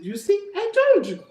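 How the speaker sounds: high little rabbit asking innocently; high clever rabbit voice; rabbit's voice. Acted.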